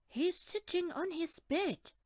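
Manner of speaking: put-on high little boy voice. A narrator's reading. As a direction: answer like a small boy. Larry answers.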